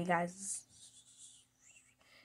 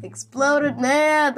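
louder, slow, through laughter; giggling repeat of a silly phrase; laughing; 8 semitones higher, 15 dB louder, much slower than usual. Real, not acted. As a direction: crack up saying it.

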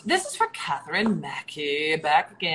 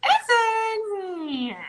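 shout; 5 dB louder than usual. Real, not acted. Sudden shriek.

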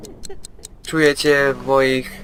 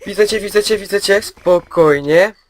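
high, louder nervous chatter; tense alarm; 8 semitones higher, 5 dB louder than usual. Real, not acted. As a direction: blurt it out as the monster appears. Spotting the monster, tense. (wysoko, nerwowo, na widok potwora)

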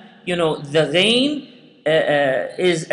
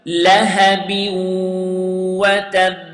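two-second elongation. Shown as a demonstration. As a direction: draw the vowel out two beats. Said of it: "repeat the word together".

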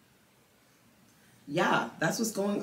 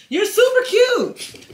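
much higher, louder pet-talk voice; 9 dB louder, slower than usual. Real, not acted. Owner praising her dog Denim while grooming, high cooing baby talk. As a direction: coo at the dog like a doting mom.

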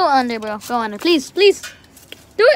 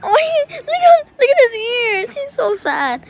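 high, doting pet talk; sweet pet talk to a scared dog; cooing at pet; 10 semitones higher, 4 dB louder, slower than usual. Real, not acted. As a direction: coo over the dog's ears.

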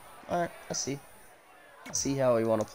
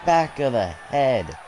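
excited commentator; 2 semitones higher, slower than usual. Acted. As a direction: pop for the super kick. Calls a big move.

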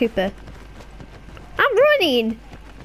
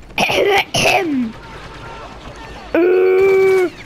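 Chewbacca roar; 3 semitones higher than usual. Pretend. Gamer showing off his Chewbacca impression to viewers, throaty Wookiee growl. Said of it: "This is my Chewbacca impression".

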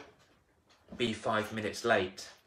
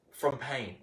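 ordinary talk

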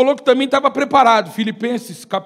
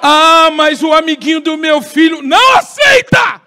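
mock parent excuse; 5 semitones higher, 10 dB louder, slower than usual.

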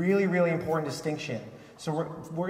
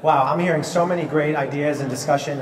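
loud, raised voice over the crowd's chatter; calling the room back; 8 dB louder than usual. Real, not acted. Speaker to chatting audience, calling them back loudly. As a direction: project to pull everyone's attention back.

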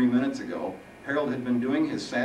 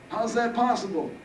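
put-on old man voice; 13 semitones higher, 3 dB louder than usual.

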